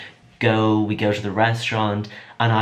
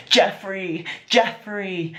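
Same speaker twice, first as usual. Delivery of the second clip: excited calling out; 10 semitones higher, much slower than usual. Real, not acted. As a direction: call his name high and eager from the chair. Calling after passing star.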